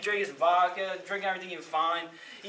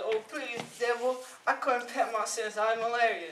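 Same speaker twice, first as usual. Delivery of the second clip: higher-pitched begging excuse; pleading; 4 semitones higher than usual. Acted. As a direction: plead pitifully for more time. Jack begs the devil for time.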